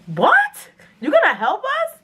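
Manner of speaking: high, stunned question; can't believe she's helping; shocked disbelief. Retold or imitated. Boyfriend shocked.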